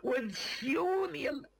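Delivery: high, drawn-out wail of pain; agonized pleading. Acted. Wounded man begging a stranger to kill him, agonized pleading. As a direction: beg like a man who can't bear it.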